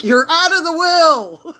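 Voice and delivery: high, drawn-out loud proclamation; mock outrage. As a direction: imitate a customer's exaggerated outburst. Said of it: "He's like".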